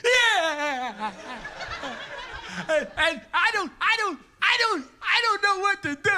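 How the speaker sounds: high, loud, blubbering wail; crying kid. Retold or imitated. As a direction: sob it out like a helpless schoolboy.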